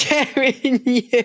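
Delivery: high, slow laughing echo. Real, not acted. Friend mock-repeating through laughter.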